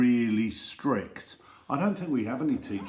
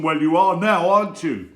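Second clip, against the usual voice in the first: loud, high gotcha retort; triumphant teacher; 7 semitones higher, 11 dB louder than usual. Acted. Strict teacher snapping back at the pupil, loud and triumphant. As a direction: catch him out with relish. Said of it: "teacher retorts".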